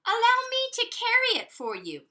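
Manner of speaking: high, sweet-talking dog; sugary high dog offering help; sly dog voice. Acted. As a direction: coax her like a sly dog. Mister Dog voiced in a story.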